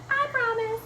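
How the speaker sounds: high little cat voice; promising cat. Acted. Cat answers Mister Gumpy, high-pitched promise. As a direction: promise in a small, high kitty voice. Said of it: "Cat said".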